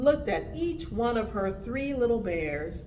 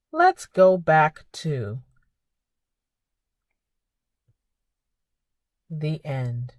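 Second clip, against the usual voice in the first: put-on reading to children; 7 dB louder than usual. Reads picture book to children.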